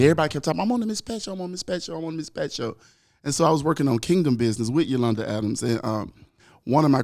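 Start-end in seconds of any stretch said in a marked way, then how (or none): 0.6-2.8 high, soft, repetitive parroting; imitated chatter. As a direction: parrot people's talk in a raised, lighter voice. Mimics everybody repeating the same boast.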